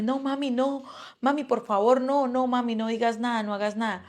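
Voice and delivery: retold tearful pleading. Frightened daughter begging her mother not to act, retold pleading. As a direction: plead in a rush of repeated no's, imitating a child. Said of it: "Ella empieza a llorar, a decirme".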